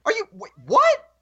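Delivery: a stunned fan's imitated double take; mock disbelief. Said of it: "It's like".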